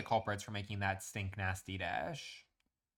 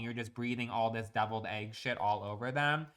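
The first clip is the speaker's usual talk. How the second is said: ordinary talk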